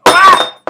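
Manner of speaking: a sharp, very loud, high cry of pain; sudden pain yelp. Real, not acted. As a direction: yelp as the sharp edge cuts. Cuts himself on the sharp puck.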